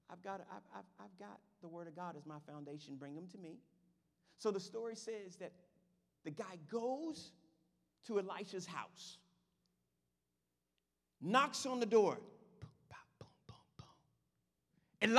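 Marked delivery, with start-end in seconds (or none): 0.1-3.6 calm prophet. Retold or imitated. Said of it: "Elisha hears about it and says". 12.6-15.2 whispered knocks. Retold or imitated. Knocking on the door.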